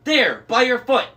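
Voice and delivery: high, loud pointing-out; urgent shout. A narrator's reading. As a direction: call out urgently over the chaos. Frantic directions during the chaos.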